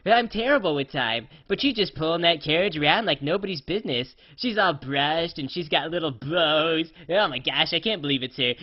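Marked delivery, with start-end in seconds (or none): none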